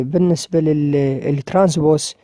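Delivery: ordinary talk, nothing marked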